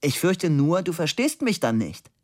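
lightly shouted objection; calling out. A narrator's reading. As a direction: call it to a hard-of-hearing friend. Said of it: "rief Fabian".

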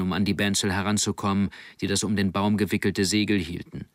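ordinary delivery, nothing marked

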